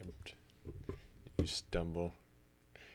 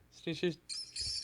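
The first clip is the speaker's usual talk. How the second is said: mouse sneezes; 13 semitones higher than usual. Acted. Mice sounds imitated.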